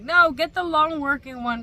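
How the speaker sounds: loud, emphatic mock insistence; insistent advice. Everybody on TikTok urging her, loud insistence. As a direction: call it out like a TikTok crowd pushing. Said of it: "everybody was like".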